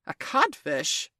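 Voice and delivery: slow, stunned echo; blankly drawn-out question. A narrator's reading. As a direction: repeat it slowly, as if blankly stunned. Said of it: "Hook echoed blankly".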